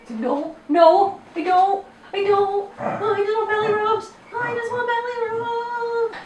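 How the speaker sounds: very high, squeaky pretend dog voice; cartoonish high dog voice, stretching out at the end; voicing the dog. Voices the dog's imagined answer.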